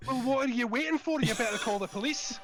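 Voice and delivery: put-on Scottish accent. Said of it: "in a Scottish accent".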